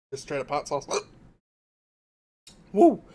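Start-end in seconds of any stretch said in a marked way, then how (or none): 2.7-3.0 loud woo. Real, not acted. Reacting to hot sauce.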